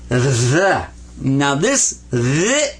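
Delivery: "the" with a long buzzing voiced TH; heavy TH. Shown as a demonstration. Teacher introduces the Arabic letter, "the" with heavy voiced TH. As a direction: lean hard on the TH of "the". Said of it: "is a real heavy T H sound".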